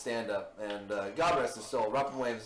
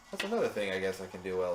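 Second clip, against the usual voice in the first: ordinary talk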